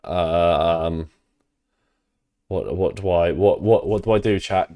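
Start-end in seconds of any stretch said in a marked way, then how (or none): none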